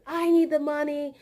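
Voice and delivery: high, loud, pushy retelling of her; mock loud demand. Coworker demanding her pay, imitated loud and high. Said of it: "she just said".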